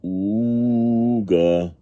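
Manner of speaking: long, loud "ooga" horn; big freighter horn. A narrator's reading. Big freighter sounding off, long loud horn noise. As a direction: sound a ship's horn, drawn out. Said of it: "says the big freighter".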